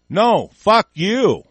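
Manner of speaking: slow, loud, high-pitched refusal; angry retort. Real, not acted. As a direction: hurl it back, each word punched. Angry retort to war supporters.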